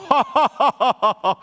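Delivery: booming high giant's laugh. Acted. Goliath laughing at the Philistine king, booming laugh. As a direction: laugh big like a mocking giant. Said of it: "Goliat langsung ketawa".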